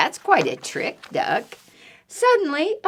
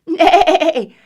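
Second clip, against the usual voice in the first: high, loud quavering whinny; horse neigh; 10 semitones higher, 9 dB louder than usual. Acted. Horse answers duck's greeting, loud whinny. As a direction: neigh it out loudly.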